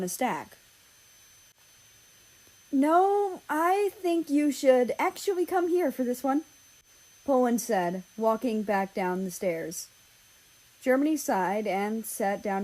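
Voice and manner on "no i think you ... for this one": calling. Acted. Poland calls Germany to come.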